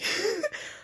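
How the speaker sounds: short high embarrassed giggle. Real, not acted. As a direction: laugh off your own joke. Joke about therapy.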